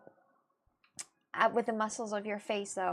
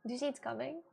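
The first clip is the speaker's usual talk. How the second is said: soft, teary fake cry, voice thin and hushed; fake crying on request; 4 dB quieter than usual. Streamer fakes crying on command for chat, teary and hushed. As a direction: squeeze out a pretend sob for chat. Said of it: "fake cry right now".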